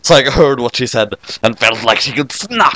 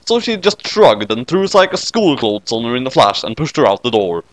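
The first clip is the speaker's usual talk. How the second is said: ordinary delivery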